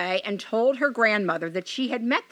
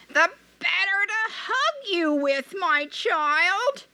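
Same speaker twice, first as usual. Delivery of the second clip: high sweet false granny voice; sugary old granny hiding a wolf; a wolf putting on a sweet granny voice; wolf as grandmother; 10 semitones higher, slower than usual. Acted.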